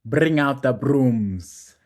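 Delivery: slow, loud hype shout; hyped shout. Real, not acted. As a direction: drag out a loud celebration. Celebrating sweep, hyped.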